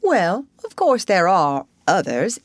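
lightly voiced Rat, pausing before admitting it; hesitating. A narrator's reading. Rat reluctantly explains to Mole, hesitating. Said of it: "in a hesitating sort of way".

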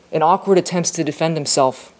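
ordinary delivery

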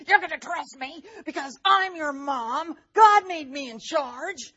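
loud, high, forceful scolding; demonstrated yelling mom. Shown as a demonstration. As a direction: yell it at the kids, stretching the end. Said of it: "I could talk like this to them instead of".

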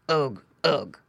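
low piggy grunts; two short pig grunts; grunting pig. A narrator's reading. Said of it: "grunted Squinty".

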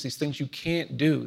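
normal talking voice

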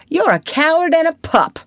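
high, loud taunting yell; boyish shout. Acted. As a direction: shout the insult up high like a furious boy. Tom insults the new boy.

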